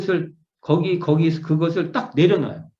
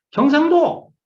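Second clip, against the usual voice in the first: exclaiming the place; 6 semitones higher, 6 dB louder, slower than usual. Acted. Calling out arrival in Gyeongsang.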